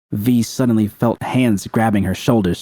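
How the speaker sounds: plain reading voice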